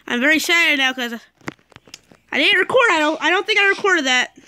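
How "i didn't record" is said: normal talking voice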